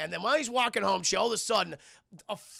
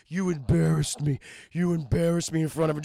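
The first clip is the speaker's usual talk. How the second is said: Kingpin; 2 semitones lower, 6 dB louder than usual. Acted. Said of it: "he goes Kingpin".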